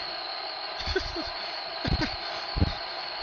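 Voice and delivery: high breathy giggles; rising giggle. Acted. Pinkie facing the pony, high rising giggles. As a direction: giggle as confidence grows. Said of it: "each sound that came out of her".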